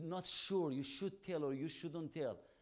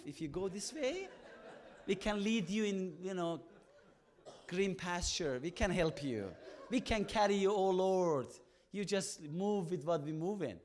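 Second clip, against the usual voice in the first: rising, louder, urgent plea; impassioned prayer building up; pleading with God; 3 semitones higher, 5 dB louder than usual. Retold or imitated. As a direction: bargain with God, getting louder. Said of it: "We try to say".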